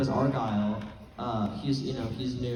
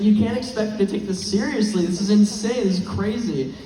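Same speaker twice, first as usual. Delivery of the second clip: exasperated quote; 6 semitones higher, 8 dB louder than usual. Retold or imitated. Eduardo quoted loud.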